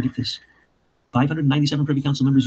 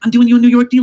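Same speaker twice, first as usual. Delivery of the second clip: loud, high-pitched dealmaker's insistence; acted exasperation; 8 semitones higher, 9 dB louder than usual. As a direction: push the deal, voice raised high. Acted negotiation, Trump pushing back.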